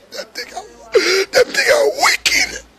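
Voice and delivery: high, slow, sobbing voice breaking apart; real sobbing. Real, not acted. Condemning gun songs that kill the youth, sobbing. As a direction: choke the words out between sobs. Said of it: "me start cry".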